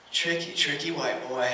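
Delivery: low, soft, sly mock of a TV line; lightly imitating mom quoting a sitcom; a hint of a sitcom catchphrase, said low and soft; TV quote. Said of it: "she quotes Everybody Loves Raymond".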